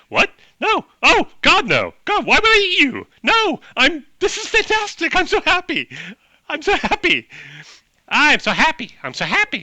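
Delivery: high, loud, giddy orc outburst; pure joy. Acted. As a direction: gush at the top of your pitch, overjoyed. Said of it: "pure joy".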